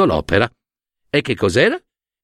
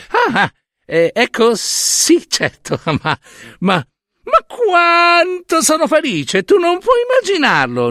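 Hera, falsely delighted. Acted. Hera greeting her returned son with much higher, falsely overjoyed voice. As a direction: gush over him with a big fake smile.

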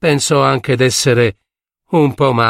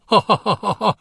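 mocking laugh; 8 semitones higher than usual. A narrator's reading.

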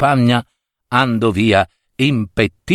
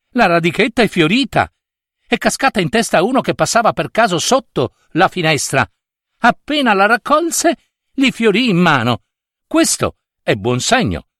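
high, fast, breaking news to the king; rushed, high-pitched messenger; excited minister; 7 semitones higher than usual. Acted. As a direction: burst in with urgent good news. Minister bursts in with good news.

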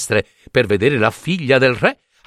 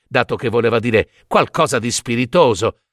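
ordinary delivery, nothing marked

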